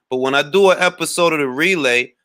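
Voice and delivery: normal talking voice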